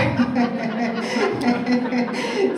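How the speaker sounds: a long laugh that climbs up; rising laugh. Real, not acted. Trainer still laughing at her water joke, rising laugh.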